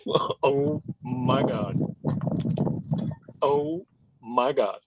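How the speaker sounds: slow, holding the laugh inside; mother barely containing her laughter; suppressed laughter. Acted. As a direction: act out stifling a laugh, words drawn out. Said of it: "She is laughing, but keeping it inside".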